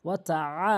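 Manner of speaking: long আ pressed from mid-throat; throaty weighted আ. Shown as a demonstration. Teacher showing the ayn, pressed throaty আ. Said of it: "ভর দিয়ে হলকের মাঝখান থেকে".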